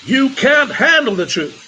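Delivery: high, loud, strained movie outburst; big hammed-up actor's shout; film actor impression. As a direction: belt out a dramatic courtroom-style film line. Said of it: "best impersonation of that actor".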